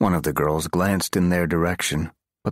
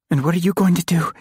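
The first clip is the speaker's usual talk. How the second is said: high, louder anxious question; 13 semitones higher, 5 dB louder, faster than usual. Acted. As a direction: press him anxiously. Frightened girl questions him.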